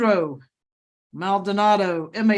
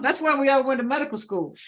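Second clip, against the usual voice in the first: usual speaking voice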